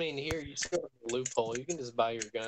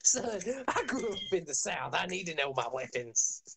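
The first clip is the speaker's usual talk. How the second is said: a higher, hammed-up Southern gun-nut twang; 6 semitones higher than usual. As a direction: play the proud Southern gunslinger. Gun-toting Southerner talks to players.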